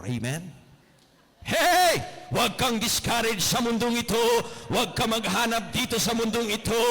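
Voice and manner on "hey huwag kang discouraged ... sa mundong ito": shouted, much higher exhortation; full-force preaching. Acted. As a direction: call the crowd up loudly. Preacher's exhortation after story. (malakas na pangangaral)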